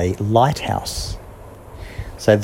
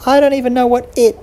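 higher squeaky mouse voice; a little mouse still pleading; 10 semitones higher than usual. Acted. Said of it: "And Mouse said".

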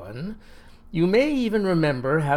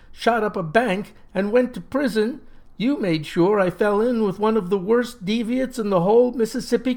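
raised, high accusing rant; angry rant; 6 semitones higher than usual. Acted. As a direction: let every grievance pour out. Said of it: "he shouted back".

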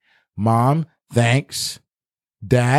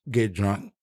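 ordinary talk

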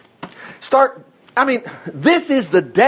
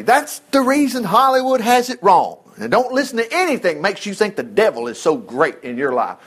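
ordinary talk, nothing marked

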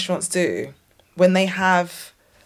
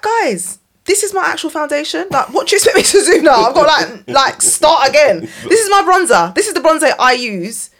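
exasperated complaint; 6 semitones higher, 5 dB louder than usual. Retold or imitated. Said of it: "And you're like".

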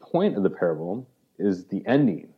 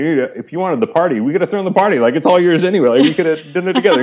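pleading father; 8 semitones higher, 8 dB louder, faster than usual. Retold or imitated. Father pleading with older son.

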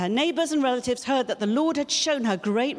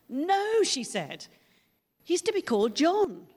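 "No" sharply high and punched, then "He's to be called John" firm; an emphatic, no-discussion no, pitched high; 6 semitones higher than usual. Retold or imitated. Elizabeth refusing the relatives, with a high emphatic no. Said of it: "a very emphatic no".

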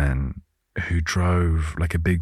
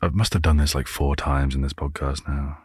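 ordinary talk

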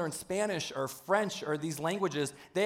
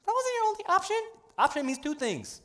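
high mock retort; 14 semitones higher than usual. Quipping response, high voice.

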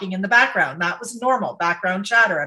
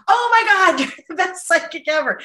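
higher, louder sarcastic praise; mocking gush; 8 semitones higher, 4 dB louder than usual. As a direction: gush over the top to mock her. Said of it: "he was mocking her".

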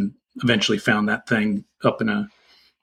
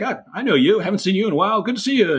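put-on high, delighted hello between old friends; cheerful greeting; 10 semitones higher, faster than usual. Birders greeting each other at the rare bird, high happy hellos. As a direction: sing out a happy reunion welcome.

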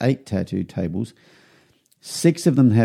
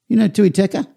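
much higher, eager Kiwi tattooist; a big guy lighting up at the word horses; tattooist voice, surprised; 11 semitones higher, 3 dB louder than usual. Retold or imitated. Tattooist asks about cousin.

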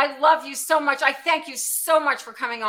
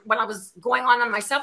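ordinary delivery

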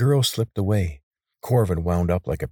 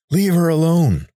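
loud, high defiant yell; shouting; 11 semitones higher, 8 dB louder than usual. A narrator's reading. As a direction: yell to drive the attacker off. Corvin yells at attacker.

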